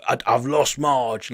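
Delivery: plaintive mock German calling; imitated German accent, the name drawn out. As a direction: call for someone slowly in a German accent. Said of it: "it was in German accent".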